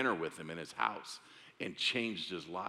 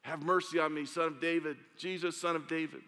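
mock shout; 6 semitones higher than usual. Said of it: "he shouts out at the top of his lungs".

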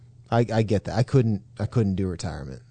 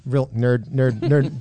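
ordinary delivery

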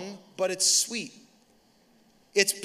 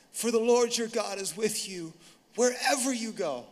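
imitated daughter reading; 2 semitones higher than usual. Daughter reads Dad the verse, imitated deliberate recital. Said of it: "She's like".

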